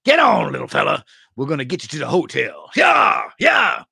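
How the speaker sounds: loud, hammed-up cowboy holler; playful cowboy whoops, high and loud; cowboy on horseback. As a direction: holler like a rider urging on a horse. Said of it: "someone to, just to ride up and go".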